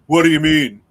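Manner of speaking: sudden loud challenge in a masculine voice; dropped masculine voice. Retold or imitated. Co-host retelling Candy Pop facing someone aggressive, loud masculine voice. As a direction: imitate her booming it out in a masculine voice. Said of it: "drop to the masculine voice".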